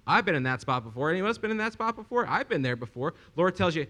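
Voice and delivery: ordinary talk, nothing marked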